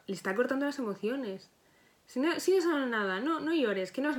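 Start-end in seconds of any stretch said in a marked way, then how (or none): 2.1-4.2 dismissive mother. Retold or imitated. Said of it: "¿la madre qué le dice a ese niño?".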